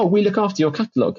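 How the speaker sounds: quick, high, offhand imitation; breezy publisher reply. Imitating the rep casually claiming his catalogue, quick and high. Publisher staff voiced in anecdote.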